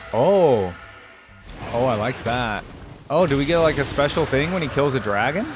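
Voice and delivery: normal talking voice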